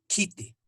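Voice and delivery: "Kite" with a puff of air after the k; aspirated K. Shown as a demonstration. Teacher repeating the Aymara KH example, aspirated K. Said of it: "fonema K H".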